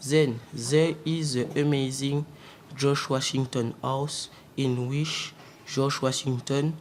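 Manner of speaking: usual speaking voice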